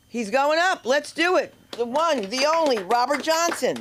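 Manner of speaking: high, excited announcer build-up; host hype. Real, not acted. Host hyping the crowd for the next comic, excited. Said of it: "host hyping up next comedian".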